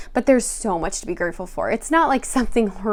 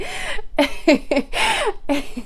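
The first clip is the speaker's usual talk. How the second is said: high teary laugh; 10 semitones higher than usual. Retold or imitated. Cradling newborn, crying.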